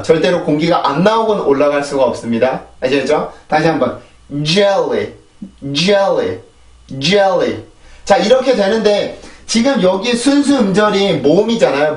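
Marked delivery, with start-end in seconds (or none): 4.4-7.6 JELLY with English tongue placement, falling at the end; English pronunciation. Shown as a demonstration. Teacher models the word for learners, English pronunciation. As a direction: say JELLY the English way, not as Korean 젤리. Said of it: "영어에서는 젤리가 아니라 젤리".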